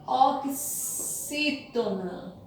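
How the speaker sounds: Oxítona very slow and soft, in pieces; dictating while writing. Shown as a demonstration. Teacher writing the term for pupils, Oxítona slowly sounded out. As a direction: spell out Oxítona softly, syllable by syllable. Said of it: "Deixa eu escrever aqui".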